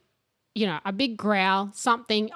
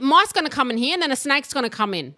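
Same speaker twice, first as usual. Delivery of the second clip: higher, louder, rushed mock scolding mother; scolding mum; 5 semitones higher, 4 dB louder, faster than usual. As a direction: fire off the mum's rant about the door. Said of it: "She'd go".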